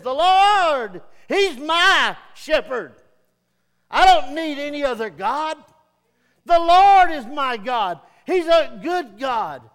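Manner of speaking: loud, proud boast, The Lord ringing out. Retold or imitated. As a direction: brag about your Lord at full voice. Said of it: "he's just boasting".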